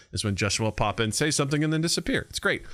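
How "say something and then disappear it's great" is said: normal talking voice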